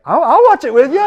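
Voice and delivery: put-on high, loud kid's voice, keen to join in; eager son. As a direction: pitch it way up like an excited boy. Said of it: "my son's like".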